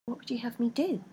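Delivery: soft pleading question; imploring. A narrator's reading. Rebecca pleading with her feet, soft imploring. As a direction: plead quietly. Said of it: "implored Rebecca".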